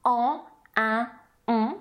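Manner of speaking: "en, un, on" as French nasals; three separate French nasal vowels. Shown as a demonstration. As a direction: say "en, un, on" through the nose, French-style. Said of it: "the French nasals".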